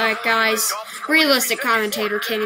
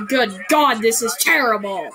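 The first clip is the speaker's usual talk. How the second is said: ordinary talk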